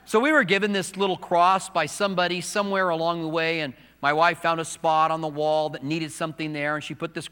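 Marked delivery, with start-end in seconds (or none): none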